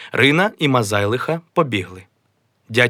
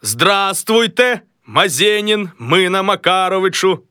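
high, slow formal greeting; a raised ceremonial hello; first greeting voice; 9 semitones higher, 3 dB louder than usual. Acted. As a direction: greet yourself grandly. Greets himself in a voice.

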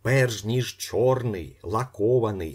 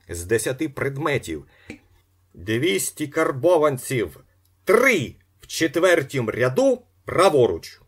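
higher, louder selling cry; auctioneer patter; 5 semitones higher, 7 dB louder than usual. A narrator's reading. Auctioneer urging the hall to bid, loud and rising. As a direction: sell the lot to the room. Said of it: "сказав він".

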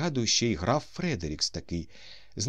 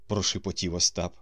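usual speaking voice

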